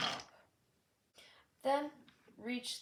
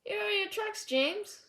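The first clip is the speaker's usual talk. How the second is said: higher, louder cheeky little engine voice; cheeky tank engine; 11 semitones higher, 6 dB louder than usual. A narrator's reading. Little tank engine teasing James, higher and cheeky. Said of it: "said the little tank engine".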